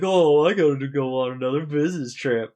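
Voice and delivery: loud, high put-on voice for a phony excuse; fake excuse. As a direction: sell the business trip lie, loud and high. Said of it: "He's like".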